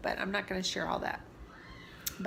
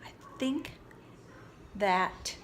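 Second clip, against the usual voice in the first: sincere whisper; 3 semitones higher than usual. Real, not acted. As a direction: confide it gently, barely voiced. Sincere confession about her purpose.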